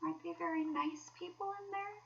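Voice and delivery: high, soft, hesitant question; a trifle nervous. Acted. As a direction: ask timidly, half afraid of the answer. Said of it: "said the mole a trifle nervously".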